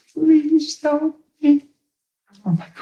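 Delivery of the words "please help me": very high, slow tearful plea; imitated crying plea. Woman in pain begging for help, imitated crying. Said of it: "She just cried".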